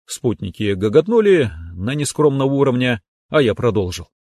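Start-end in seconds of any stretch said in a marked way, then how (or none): none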